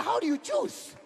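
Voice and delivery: very high, incredulous question. Retold or imitated. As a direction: ask it in squeaky disbelief. Husband asks wife, incredulous.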